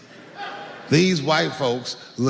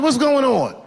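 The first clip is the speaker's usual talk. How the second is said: higher, louder alarmed question; alarmed father; 9 semitones higher, 4 dB louder than usual. Retold or imitated. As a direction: sound worried on the late call.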